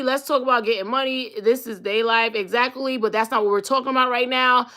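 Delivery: ordinary talk, nothing marked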